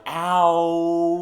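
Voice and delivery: loud pained yelp. Acted. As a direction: cry out in pain. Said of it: "I stammer back a little, like".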